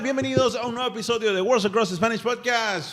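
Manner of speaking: bright show-opening greeting; host intro. Acted. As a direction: welcome listeners like a radio host.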